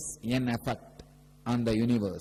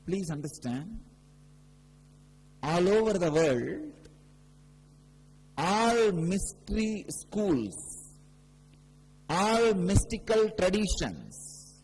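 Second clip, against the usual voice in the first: higher, louder preaching voice, slowing on key phrases; fervent preaching; 6 semitones higher, 4 dB louder than usual. Acted. Guru preaching about the third eye to devotees, raised and fervent. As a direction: proclaim it to the whole hall.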